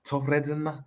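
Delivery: high-pitched playful Scouse; Scouse accent. Pretend. Co-host mimicking Liverpool fans' talk to his mate, Scouse accent. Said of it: "Hey, your Scouse accent's proper".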